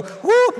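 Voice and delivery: high, loud whoop; one big high-pitched cheer; team whoop. Acted. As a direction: whoop like a team breaking the huddle. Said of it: "Everybody go".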